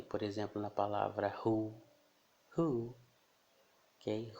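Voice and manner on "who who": WHO com som de R aspirado no começo. Shown as a demonstration. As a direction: diga WHO começando como o R brasileiro. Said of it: "do nosso R". (WHO soprado no início, como R)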